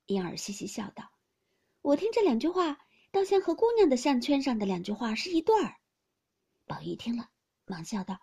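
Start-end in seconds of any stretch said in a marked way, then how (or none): 1.8-5.8 put-on a young maid's playful, higher voice; giggling maid, teasing. A narrator's reading. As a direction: tease him with a little laugh. (俏皮的丫鬟，笑着打趣)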